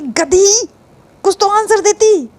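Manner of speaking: high, loud exasperated scold; loud exasperated rebuke; scolding friend. Acted. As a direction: tell her off like a fed-up best friend. Friend scolds her, calling her donkey.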